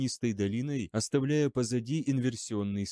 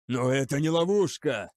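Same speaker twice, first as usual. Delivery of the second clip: louder, desperate objection; shouted protest; 3 semitones higher, 4 dB louder than usual. A narrator's reading. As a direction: shout him down. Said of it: "крикнул Грамматикус". (отчаянный крик)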